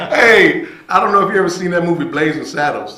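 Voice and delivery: suddenly loud and high, laughing into it; excited burst. Real, not acted. To the host, laughing at the memory, loud and high. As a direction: blurt it out, tickled by the memory.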